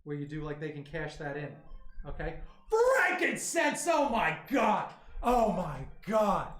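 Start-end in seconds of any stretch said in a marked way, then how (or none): 3.0-3.9 excited shout. Real, not acted. Reacting to a donation name. 3.9-6.5 stunned. Real, not acted. Stunned at the donation.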